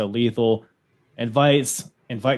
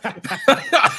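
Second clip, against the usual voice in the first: high laugh. Real, not acted. Laughs at the joke.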